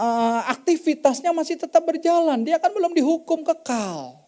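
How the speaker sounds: high, driving sermon voice; preacher rallying the congregation, fervent; fervent preaching. Real, not acted. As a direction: preach it with rising force. Preaching at full force.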